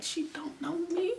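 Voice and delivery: high, slow, soft fretting; a small, high, drawn-out lament; hushed worried whisper. Retold or imitated. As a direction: murmur the fear to yourself. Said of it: "I'm like".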